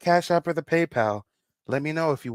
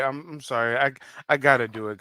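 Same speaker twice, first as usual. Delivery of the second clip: normal talking voice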